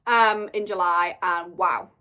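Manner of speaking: usual speaking voice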